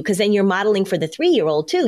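ordinary delivery

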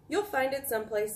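usual speaking voice

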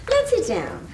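high, fluttery invitation; gushing hostess. Acted. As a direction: flutter over the guest. Fluttering hostess seating guest.

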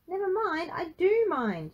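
high, loud indignant yell. A narrator's reading. Frankie refuses to be brushed off, yelling. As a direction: throw it back at him, outraged. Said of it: "yelled Frankie".

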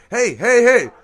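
loud, high wake-up shout; shouting hey. Retold or imitated. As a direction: bellow to wake everyone up. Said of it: "pull that shit like Static did".

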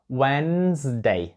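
Wednesday very slow as "wenz-day". Shown as a demonstration. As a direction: draw out Wednesday, no D. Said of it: "Буква D не вимовляється". (Wednesday дуже повільно: венз-дей)

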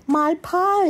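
high excited cry; exclaimed. A narrator's reading. Amelia Bedelia remembering her pie in the oven, high exclamation. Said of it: "exclaimed Amelia Bedelia".

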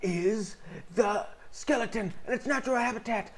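hushed-then-rising wildlife narrator voice; nature documentary. Pretend. Skeleton in its natural habitat.

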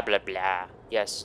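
ordinary talk, nothing marked

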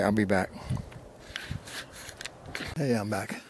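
ordinary delivery

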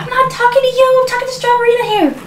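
high-pitched, hurried irritation; frantic snapping. Acted. Mother talking to her toy, frantic high rebuke. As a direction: shut the child out in a panicky whine. Snaps at child, distressed.

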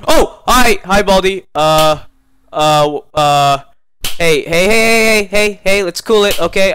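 loud, startled nervous pleading; scared horror game streamer. Real, not acted. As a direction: panic and try to calm him. Baldi appears, panicked.

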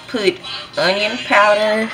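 usual speaking voice

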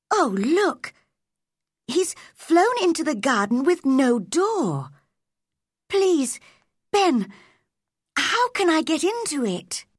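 put-on high, eager girl's voice; exclaim, then plead with high-pitched urgency; excited pleading. A narrator's reading. Mary begs Ben for a way in, excited and pleading.

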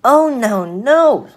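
high, loud protest as the fly; little fly crying out a refusal; little fly refusing. Acted.

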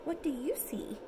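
soft whispered question. Real, not acted. Parent asks toddler about the picture, whispered. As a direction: whisper it to the little one.

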